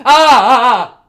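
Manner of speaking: loud, high "ah" screams in a row; panicked scream. Real, not acted. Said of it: "Oh my goodness, I'm panicking".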